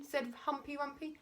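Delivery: ordinary delivery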